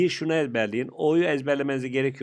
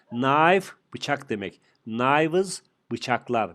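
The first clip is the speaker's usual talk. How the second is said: slow teaching; much slower than usual. Shown as a demonstration. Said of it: "teacher slow model of plurals".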